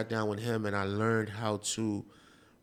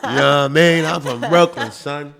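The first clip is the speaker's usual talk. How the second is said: louder, hammed-up Brooklyn tough; loud mock Brooklyn brag to a Maryland guest; Brooklyn swagger; 4 semitones higher, 13 dB louder than usual. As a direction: boast like a proud Brooklyn guy. Voicing a proud Brooklyn local.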